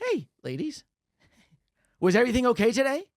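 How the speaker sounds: bright high fake-nice waiter question; sweet service voice with an edge; fake polite waiter. Waiter asks the ladies.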